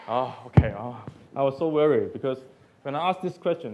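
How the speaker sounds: ordinary talk, nothing marked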